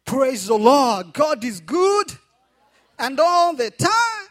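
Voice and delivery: loud call to the congregation; preaching at full force. Real, not acted. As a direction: proclaim it loud and slow.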